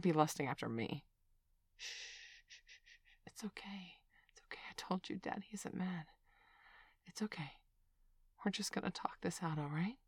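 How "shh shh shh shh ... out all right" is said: soft hushing reassurance; calm her down, gently; soothing whisper. Acted. Comforting a crying child.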